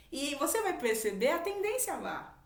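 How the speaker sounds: ordinary talk, nothing marked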